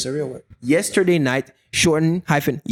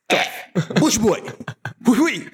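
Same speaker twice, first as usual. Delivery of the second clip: mock Ghanaian girl; 11 semitones higher than usual. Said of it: "You be like".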